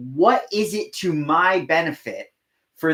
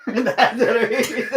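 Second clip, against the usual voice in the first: laughing; 7 semitones higher, slower than usual. Real, not acted. Laughing after punchline.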